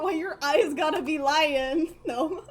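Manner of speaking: ordinary talk, nothing marked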